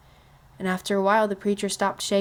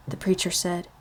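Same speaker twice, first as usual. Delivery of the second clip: ordinary delivery, nothing marked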